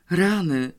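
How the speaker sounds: mild, uneasy groan; faintly dismayed; uncertain moan. A narrator's reading. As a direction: let out a worried little groan. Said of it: "jęknął niepewnie".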